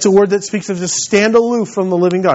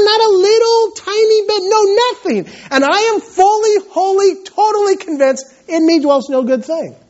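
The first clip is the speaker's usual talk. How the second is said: high, impassioned preacher's cry; fervent preaching; 8 semitones higher than usual. Acted. Pastor confessing inability to his congregation, impassioned preaching. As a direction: pour out the confession to the church.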